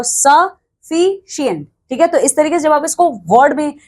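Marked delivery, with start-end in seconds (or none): 0.0-1.7 सफिशिएंट said slowly, syllables drawn apart; slow sounding out. Shown as a demonstration. Teacher showing students how to read the word, slow sounding out. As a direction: pronounce सफिशिएंट slowly so students hear each vowel. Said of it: "देखो".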